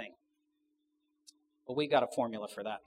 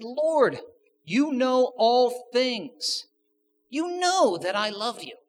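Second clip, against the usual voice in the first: high, loud, drawn-out plea; wounded, insistent protest; hurt Peter; 11 semitones higher, 9 dB louder, much slower than usual. Acted. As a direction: plead slowly, voice raised in hurt. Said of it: "Peter was hurt".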